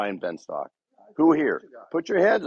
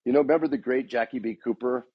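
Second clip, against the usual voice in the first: ordinary delivery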